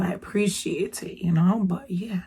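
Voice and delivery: breathy whisper trailing off; whispered aside. Real, not acted. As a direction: let it slip out as a hushed aside.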